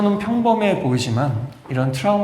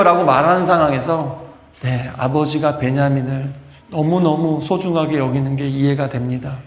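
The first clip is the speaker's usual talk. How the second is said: ordinary talk, nothing marked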